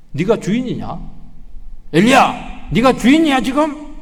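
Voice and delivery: loud high rebuke; fiery preaching. Acted. Preacher rebuking Elijah and the congregation, fiery. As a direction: rebuke them from the pulpit.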